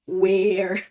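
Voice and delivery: "where" drawn out, dipping and turning up; long scooped "where"; third-tone dip. Shown as a demonstration. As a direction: bend "where" down then up. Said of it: "is the third tone".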